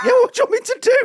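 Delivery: very high, loud mock protest; exasperated retort. Comedian answering the stranger talking about him, mock exasperated. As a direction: shriek it back in comic exasperation.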